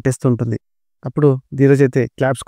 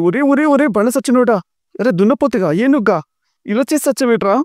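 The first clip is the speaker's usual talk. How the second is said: scolding village woman; 9 semitones higher than usual. Acted. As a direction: rant at him like an outraged aunt. Valli scolds Vishwa angrily.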